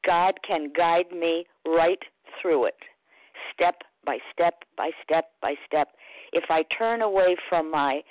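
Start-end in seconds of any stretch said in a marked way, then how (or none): none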